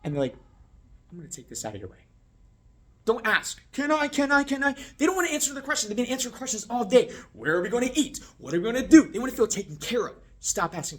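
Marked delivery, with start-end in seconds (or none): 1.1-2.1 soft, lower, unobtrusive; discreet server. Shown as a demonstration. Discreet server clearing the table. 3.7-4.7 high, loud repeated pleading; mock pestering. Mocks servers who keep asking. 7.3-9.1 high, loud, hammed-up pestering; mock nagging questions. Mimics questions guests face all day.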